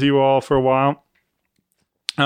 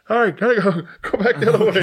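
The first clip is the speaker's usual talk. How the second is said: imitating singer; 7 semitones higher, faster than usual. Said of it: "he's like".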